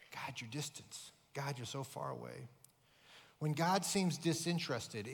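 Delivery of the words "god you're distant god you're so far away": quiet lament. Retold or imitated. Voicing a discouraged prayer.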